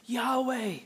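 Yahweh lifted high, without extra volume; high-pitched, emphatic Yahweh as a prayer's opening. Acted. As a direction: raise the pitch to set Yahweh apart. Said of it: "It's emphatic".

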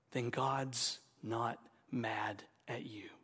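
hushed and slow, unvoiced; slow whisper. Real, not acted. Preacher whispering comfort to believers. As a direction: whisper it slowly like a secret.

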